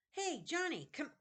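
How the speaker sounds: high, loud first try at the bully's call; a false start in the wrong character voice; wrong voice for Sebastian. Shown as a demonstration. Reader trying Sebastian's voice, wrong pitch first. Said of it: "wrong voice".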